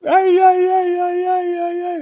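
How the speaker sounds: loud, high football cheer; celebratory shout. Real, not acted. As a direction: whoop it like a terrace chant.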